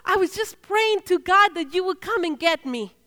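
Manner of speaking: high, loud burst of relieved joy; imitating an overjoyed man, loud and high. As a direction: blurt it out, thrilled to be fetched. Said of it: "And he was so happy".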